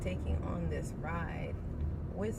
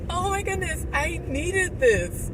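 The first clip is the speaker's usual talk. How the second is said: much higher, louder, slow gushing; higher, louder "Oh my goodness" joy; overjoyed relief; 11 semitones higher, 8 dB louder than usual. Retold or imitated. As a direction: gush with delighted relief.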